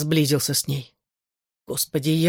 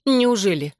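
mild reproach, pitched higher; reproachful; 4 semitones higher than usual. A narrator's reading. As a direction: toss out a reproachful question. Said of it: "с упрёком бросила она". (с лёгким упрёком)

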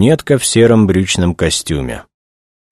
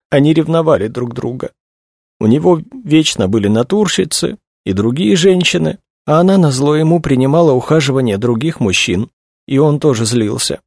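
high, tearful female character voice; distressed young woman; 9 semitones higher than usual. A narrator's reading. Young woman tells investigators about her friend, tearful. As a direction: keep it pitched well up, upset and confiding.

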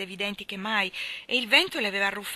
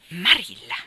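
loud, grave pronouncement; solemn remark; 5 dB louder than usual. Acted. Rachel Lynde solemnly pities Marilla's task. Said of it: "con una indescrivibile solennità".

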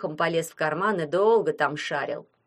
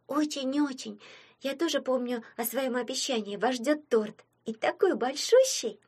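put-on kind little girl; 6 semitones higher than usual. A narrator's reading. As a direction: speak higher and gently, promising a treat. Anya thanks and promises cake.

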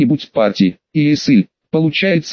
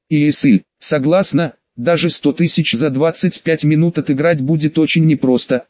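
ordinary delivery

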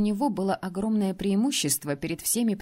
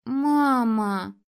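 a small daughter calling through the door, louder; little girl calling; 4 semitones higher, 5 dB louder than usual. A narrator's reading. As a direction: call Mama like a little girl. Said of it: "позвала ... маленькая дочка".

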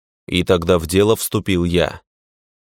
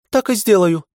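high-pitched, readily agreeing; compliant reply; 13 semitones higher than usual. A narrator's reading. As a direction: give in with surprising willingness. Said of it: "неожиданно покладисто ответила Ника". (покладистый ответ, высоким голосом)

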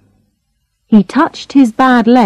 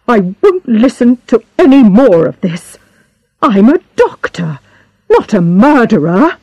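raised pitch, affronted denial; indignant protest; 5 semitones higher, 3 dB louder than usual. A narrator's reading. Doctor answers a murder accusation, indignant protest. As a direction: stand up to the accusation, mildly heated.

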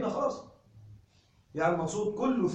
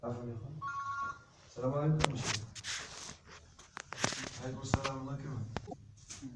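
far and quiet, away from the mic; off-mic aside; 7 semitones lower, 13 dB quieter, much faster than usual. Real, not acted. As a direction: turn away to answer a greeting. Returns a greeting off-mic. (رد السلام بعيدا عن الميكروفون)

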